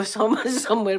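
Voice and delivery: ordinary talk, nothing marked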